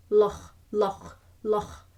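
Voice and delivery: loch with a throaty ch; Scottish loch. Shown as a demonstration. Teacher drilling the Scottish loch. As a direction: say loch with the rasp at the end. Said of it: "practice the Scottish loch".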